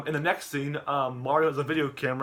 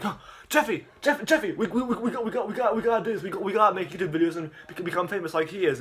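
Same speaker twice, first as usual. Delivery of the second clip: high, eager rush of ideas; mock-excited dad, higher; excited Mario; 6 semitones higher than usual. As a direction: get fired up about getting famous. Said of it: "Mario's like".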